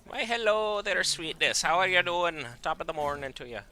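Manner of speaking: loud, high put-on Irish greeting; mock Irish accent. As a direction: ham up a vague Irish brogue, pitched high. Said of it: "I don't even know what accent I'm trying to do".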